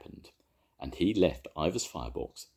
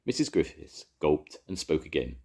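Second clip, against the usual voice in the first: ordinary delivery, nothing marked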